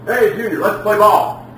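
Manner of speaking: high, eager invitation; playful parent. Acted. Parent inviting son to play ball, eagerly.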